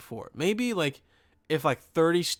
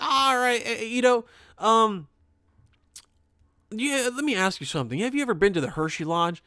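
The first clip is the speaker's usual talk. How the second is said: put-on pitched up, drawled old-customer accent; mock the customer's funny way of talking; imitating his accent; 8 semitones higher, 3 dB louder than usual. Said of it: "this guy's accent was so fucking funny".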